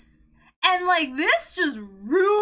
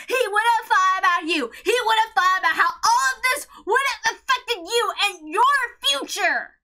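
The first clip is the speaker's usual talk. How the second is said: impassioned plea; 4 semitones higher than usual. Real, not acted. Fan addressing Sayo directly, impassioned tearful plea. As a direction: plead with her, urgent and tearful.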